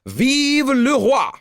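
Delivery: put-on high, slow, drawn-out drunken cry; a tipsy nobleman hollering for his king; drunken shout. A narrator's reading. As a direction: roar it like a drunk royalist. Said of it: "arrived home shouting".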